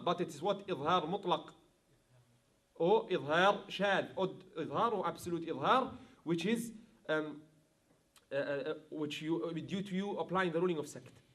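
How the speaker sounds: ordinary talk, nothing marked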